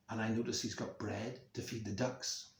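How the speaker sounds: plain reading voice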